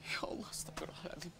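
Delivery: hushed, despairing whisper; whispered prayer. Acted. Evicted younger brother alone, praying in a whisper. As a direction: whisper to God in distress.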